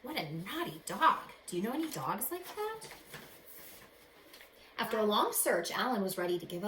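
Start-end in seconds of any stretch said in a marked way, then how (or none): none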